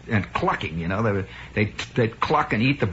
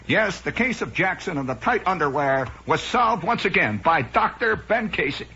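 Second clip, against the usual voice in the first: raised, declaiming narrator voice; TV announcer; 6 semitones higher than usual. Acted. Announcer summing up the solved case, mock-grand delivery. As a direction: proclaim it like a show's sign-off. Mimics the show's closing announcer.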